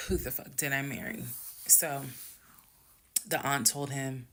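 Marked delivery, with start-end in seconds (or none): none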